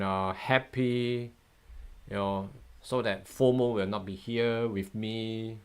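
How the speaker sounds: ordinary talk, nothing marked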